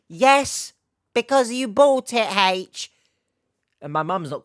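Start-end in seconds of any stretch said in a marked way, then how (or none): 0.1-2.9 high, loud mock-mum yell; hammed-up parental outburst; exasperated shout. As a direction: shout back like a fed-up mum. Acts mum's exasperated reply to him.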